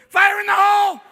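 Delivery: loud, high, frantic bathroom emergency yell; desperate dad at the bathroom door. Acted. Dad after morning coffee yelling to get into the bathroom. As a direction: pound on the door and holler. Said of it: "Dad has his morning coffee".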